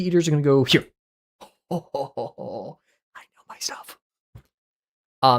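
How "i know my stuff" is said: whisper. Real, not acted. Muttering to himself after a laugh.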